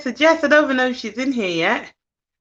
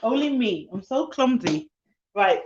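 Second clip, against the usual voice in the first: normal talking voice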